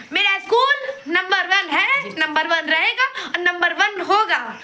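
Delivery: slow, proud advert voice, every word sold; school ad slogan. Retold or imitated. Student mocking her school's self-promotion, slow advert voice. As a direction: boom it out like a school billboard jingle. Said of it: "बस अपनी advertisement".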